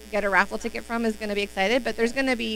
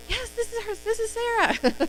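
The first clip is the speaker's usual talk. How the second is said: imitating the winner; 13 semitones higher than usual. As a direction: play the delighted winner picking up. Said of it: "And they're like".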